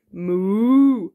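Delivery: long cow moo. Shown as a demonstration. Parent correcting toddler on cow sound, long moo. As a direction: draw it out like a cow. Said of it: "No, a cow says".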